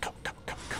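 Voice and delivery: urgent hushed go go go; whispering. Pretend. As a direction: whisper them onward fast.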